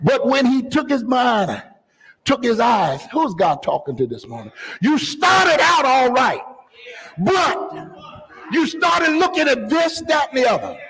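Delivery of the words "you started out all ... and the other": impassioned preaching, pitched high; predicazione infervorata. Real, not acted. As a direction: preach it with force, drawing out key words. Preacher at full force.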